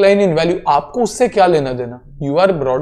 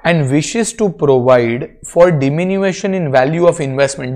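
ordinary talk

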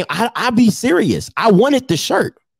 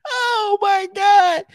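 amused exasperation; 11 semitones higher, much slower than usual. Real, not acted. Overwhelmed by the photo.

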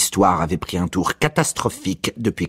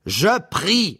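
angry; 13 semitones higher than usual. A narrator's reading. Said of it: "répondit coléreusement Ignatius".

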